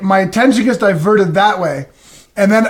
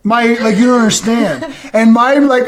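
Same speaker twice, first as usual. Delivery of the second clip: normal talking voice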